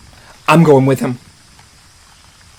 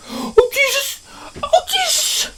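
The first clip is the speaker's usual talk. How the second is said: much higher panicked wail; terrified high cry; 5 dB quieter, slower than usual. Retold or imitated. Girl in the car seeing the T-Rex, high terrified cry. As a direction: cry out in sheer terror, voice shooting up.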